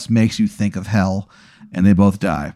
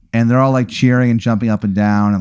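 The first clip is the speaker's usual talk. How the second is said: usual speaking voice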